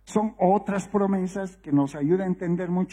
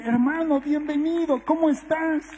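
high-pitched, re-enacted glad greeting; retold hearty welcome; 9 semitones higher than usual. Pastor greeting the drunk son instead of scolding, hearty welcome. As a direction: greet him brightly, pitched well up.

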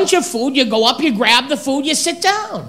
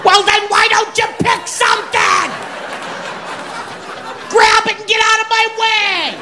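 exasperated yelling; 7 semitones higher than usual. Acted. Yelling at browsing skinny person.

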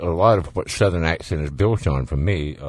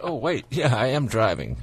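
high startled mock double-take; startled driver catching himself; sudden realization; 8 semitones higher than usual. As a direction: act like you just woke up at the wheel. Driver snapping back to awareness.

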